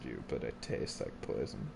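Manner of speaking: eerie low whisper. Pretend. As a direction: breathe it out like a ghost. Repeats line in eerie whisper.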